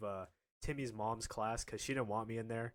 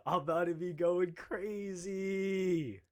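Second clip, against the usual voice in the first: drawn-out, higher hollered boast; long, raised, rowdy call; hyped voice message; 5 semitones higher, 3 dB louder, much slower than usual. Retold or imitated. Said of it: "she helped me send a voice message".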